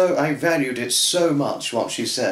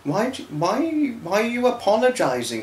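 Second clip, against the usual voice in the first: much higher, puzzled quoting of a friend; a hint of Michelle's surprised voice; imitating Michelle; 9 semitones higher than usual. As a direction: lightly voice her asking why he apologises. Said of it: "she was like".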